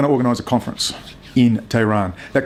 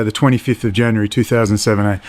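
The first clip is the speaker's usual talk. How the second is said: ordinary talk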